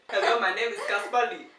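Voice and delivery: put-on South African voice; South African greeting, clearly voiced; South African accent. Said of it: "South African".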